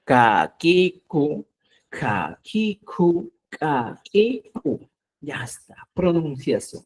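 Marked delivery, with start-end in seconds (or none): 0.0-4.8 kakiku three times, slowly and clearly; slow model for repeating. Shown as a demonstration. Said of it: "pronuncie, diga".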